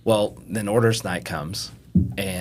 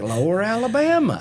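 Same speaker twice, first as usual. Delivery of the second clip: drawn-out Southern Mississippi drawl, pitched higher; 12 semitones higher than usual. Retold or imitated. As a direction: imitate a Mississippi classmate drawling his words. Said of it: "he had that Mississippi drawl".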